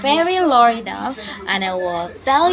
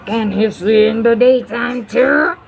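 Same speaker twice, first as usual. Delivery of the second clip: sly red fox; 3 semitones higher, 6 dB louder than usual. Acted. The red fox asks the owl a mocking question. Fox repeats, sobbing laugh.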